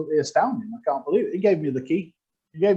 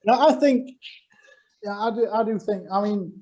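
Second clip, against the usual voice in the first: normal talking voice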